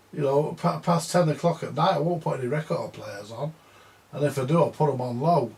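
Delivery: usual speaking voice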